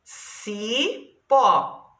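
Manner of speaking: "Cipó" split into ci, pause, pó; syllable by syllable. Shown as a demonstration. Teacher shows pupils two syllables, "Cipó" split ci, pó. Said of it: "duas palavras que têm duas sílabas".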